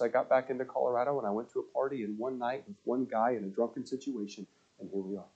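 falling away to a soft, sorry near-whisper; quiet confession. Retold or imitated. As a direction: let her shame drag the words down. Her sad confession, retold.

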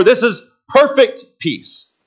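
PERFECT high and drawn out, then PEACE set apart; emphatic PERFECT PEACE. Shown as a demonstration. Preacher explains the translation, weighty stress on PERFECT PEACE. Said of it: "It's extra emphasis on the word peace".

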